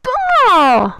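shrill bleating yell; goat scream. Real, not acted. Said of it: "C'était un cri de chèvre".

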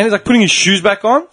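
ordinary talk

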